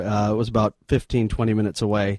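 normal talking voice

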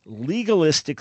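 ordinary delivery

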